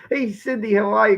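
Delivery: ordinary talk, nothing marked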